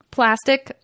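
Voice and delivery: ordinary delivery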